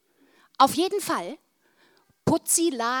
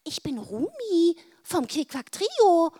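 high character voice; 7 semitones higher, 4 dB louder, slower than usual. A narrator's reading. Rumi voiced.

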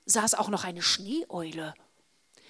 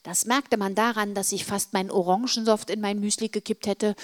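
ordinary delivery, nothing marked

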